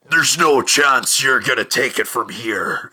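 slow, hammed-up wrestler growl; slow, mock-tough wrestler promo voice; Hulk Hogan impression. Butler refusing the SAS soldiers, in a mock Hulk Hogan voice. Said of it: "Is he Hulk Hogan?".

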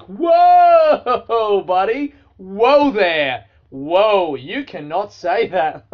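high, loud drawn-out whoa; calling him out. Retold or imitated. To a bloke in the pub, a loud high warning whoa. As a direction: rein in a rude stranger loudly. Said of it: "I was like".

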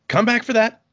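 normal talking voice

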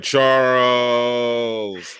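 long, drawn-out, grand "Charles"; enthusiastic. Retold or imitated. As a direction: stretch "Charles" with mock enthusiasm. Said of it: "they were like".

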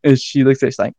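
ordinary talk

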